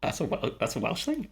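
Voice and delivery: mildly disbelieving question; retold surprise. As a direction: recall being taken aback, pitch lifting.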